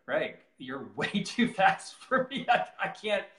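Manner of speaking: slow, laughing plea to a friend; mock protest. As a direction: plead half-laughing, drawing it out, pitch climbing. Retells his protest to faster friend.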